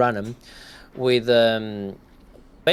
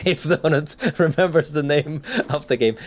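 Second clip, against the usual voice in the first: real chuckle running through the words, higher pitch; laughing; 5 semitones higher than usual. Real, not acted. Podcast host hoping a co-host remembers the game's name, laughing. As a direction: talk through an amused laugh.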